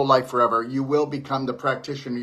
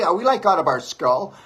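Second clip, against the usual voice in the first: incredulous; 5 semitones higher, 5 dB louder than usual. Real, not acted. Scoffing at people trusting doctors.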